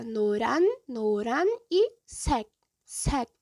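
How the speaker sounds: noran then sek split slowly with a stopped k; syllable by syllable. Shown as a demonstration. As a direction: break the word into its parts. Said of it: "o kyo tem som de K mudo".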